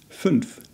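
"Fünf" with the umlaut vowel, close to the vowel in "took"; German Fünf. Shown as a demonstration. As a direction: round the lips for the ü in "Fünf". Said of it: "like the vowel sound in the word took".

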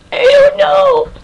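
high, drawn-out exclamation; helpless high-pitched outburst; exasperated cry. Real, not acted. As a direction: throw it up high and stretch it out. Exasperated reaction to show's puzzle.

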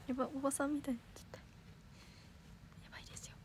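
fast, unvoiced self-mocking whisper; quick whisper. Real, not acted. Streamer laughs at her own auntie-like voice, in a fast whisper. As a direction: rush it out under your breath.

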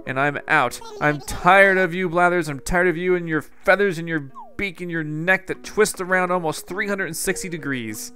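hammed-up indignant tirade; put-on anger. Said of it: "I'm not really mad. That was just a put on".

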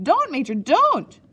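high, loud pleading cry. A narrator's reading. Boy begs the matron to stop prodding, crying out. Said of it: "I cried".

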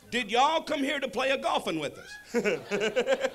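put-on slow Southern good-old-boy drawl; goober driver. Driver asking visitor about the outing, slow goober drawl. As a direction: draw it out like a goobery Texan.

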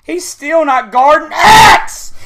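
slow, loud, rising in pitch; building frustrated shout; exasperated yell. Real, not acted. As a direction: drag it out in angry disbelief. Raging, first take before screaming.

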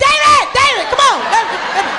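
very high, loud panicked call; urgent calling. Retold or imitated. Guardian fleeing the irate man calls cousin, urgent yelling. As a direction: yell for the kid to come, high and frantic.

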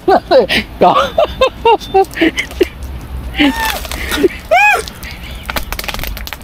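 high shrieking laughter. Real, not acted. Water poured on friend.

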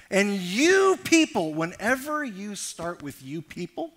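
loud, rising preacher's cadence; preaching at full force. Acted. Preacher pressing the congregation on hurt and offense, at full force. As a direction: drive the point home to the congregation.

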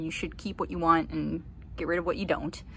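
usual speaking voice

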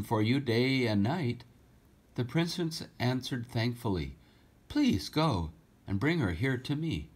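lively storybook reading, rhyming lilt; story reading. A narrator's reading. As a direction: read the verse aloud to children with feeling. Rhyming story read aloud.

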